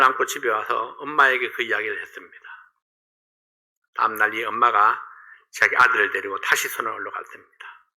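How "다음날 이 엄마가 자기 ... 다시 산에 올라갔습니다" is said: ordinary talk, nothing marked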